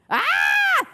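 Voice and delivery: very high, loud shriek; scream. A narrator's reading. Susan sees a monstrous creature, high scream. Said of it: "screamed Susan".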